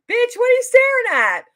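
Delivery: loud, high-pitched indignant challenge; mock confrontational yell. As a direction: call out a starer in mock outrage. Imagined angry challenge from man.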